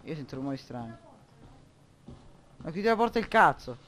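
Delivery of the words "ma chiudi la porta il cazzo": loud, higher, furious scolding; angry yelling. Real, not acted. Player yells at the guy opening the asylum door, furious. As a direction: tear into him for opening the door.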